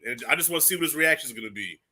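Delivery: ordinary talk, nothing marked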